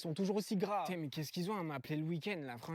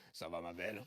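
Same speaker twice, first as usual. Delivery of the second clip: much lower, creepy macho pickup line; macho flirt voice; 13 semitones lower, 7 dB quieter than usual. Shown as a demonstration. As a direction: drop the voice and hit on her. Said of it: "un gros mascu qui drague une meuf".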